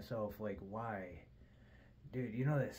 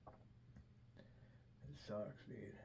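soft unvoiced grumble; hushed whispered gripe; whispered complaint; 12 dB quieter, much slower than usual. Real, not acted. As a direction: whisper it like a confession. Grumbling about waking at four.

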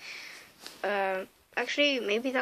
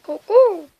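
silly cuckoo call; 9 semitones higher, 13 dB louder than usual. Acted. Sudden silly bird call.

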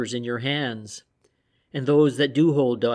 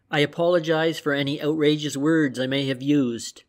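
plain reading voice

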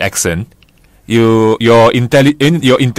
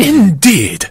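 higher-pitched mock British; British accent; 9 semitones higher than usual. Co-host proving British sounds intelligent, exaggerated British accent. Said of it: "See, it sounds more intelligent".

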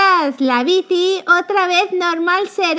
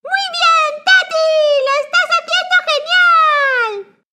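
much higher, laughing squeaky cheer; excited cheering; 10 semitones higher than usual. Acted. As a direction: cheer wildly for your little sister. Cheering Tati on. (ánimo muy agudo entre risas)

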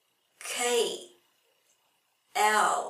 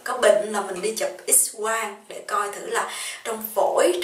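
ordinary talk, nothing marked

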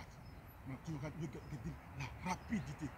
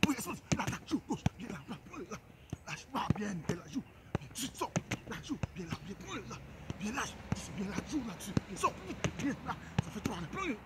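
sharp, raised coaching calls, higher and louder; loud drill calls; 5 semitones higher, 6 dB louder than usual. Real, not acted. Coach calling commands to his player during the drill, loud and urgent. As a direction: bark quick commands at a player mid-drill.

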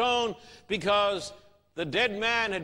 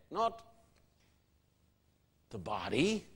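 ordinary talk

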